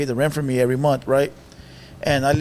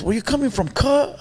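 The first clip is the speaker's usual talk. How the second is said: lightly mimicked uncle, pitched higher; imitated uncle's talk; 7 semitones higher than usual. Uncle quizzing nephew through the door, higher mimicked voice. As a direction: lift the question like the uncle talks. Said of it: "Así habla el güey".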